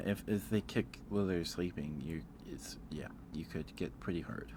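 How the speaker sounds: usual speaking voice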